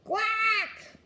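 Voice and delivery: high, loud quack; sudden comic duck-like cry; squashed squawk. A narrator's reading. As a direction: squawk like you've just been landed on. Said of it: "Luke's father said".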